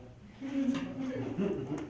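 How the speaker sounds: short amused laugh. Real, not acted. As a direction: laugh at your own joke. Joking that appraisers are lonely.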